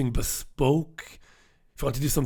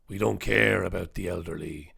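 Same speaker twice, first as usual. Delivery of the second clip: low, softer voice of cold authority; mock establishment voice; 9 semitones lower, 7 dB quieter than usual. Host mimicking the establishment on old people, low and sinister. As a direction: drop low as a callous official. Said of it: "That's the establishment approach to senior citizens".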